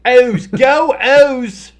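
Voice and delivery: very loud, high team chant; fan cheer. Pretend. Announcer playing a Baltimore fan cheering, high shout. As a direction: holler it like a fan in the stands.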